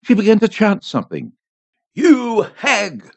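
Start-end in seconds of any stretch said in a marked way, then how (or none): 1.9-3.1 higher-pitched, mildly menacing insult; menacing cry. A narrator's reading. Priest rushes at the old woman, menacing cry. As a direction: cry it at her as a threat.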